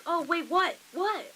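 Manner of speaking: loud, high, startled retold reaction; high-pitched double take, acted out; imitating a freak-out. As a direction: act out a friend freaking out. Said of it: "Like, she freaked out".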